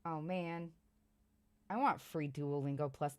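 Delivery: ordinary talk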